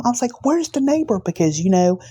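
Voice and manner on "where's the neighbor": retold yell. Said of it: "I yelled at him".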